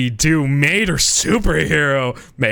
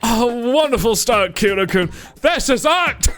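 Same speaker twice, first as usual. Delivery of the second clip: higher, theatrical art-lover voice; gushing photographer; 10 semitones higher than usual. Acted. Photographer praising Kira-kun's pose, higher and gushing. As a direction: rave like a flamboyant photographer.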